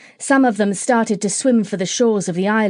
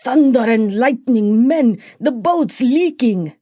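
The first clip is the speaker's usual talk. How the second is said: bellowing pirate; 3 semitones higher, 3 dB louder than usual. A narrator's reading. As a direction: call out the alarm, slowing on the leak. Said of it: "suddenly bellowed out".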